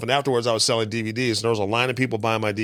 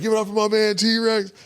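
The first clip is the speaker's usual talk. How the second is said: higher, louder MC hype; host intro; 9 semitones higher, 3 dB louder than usual. Retold or imitated. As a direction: announce him like an emcee. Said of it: "he's like".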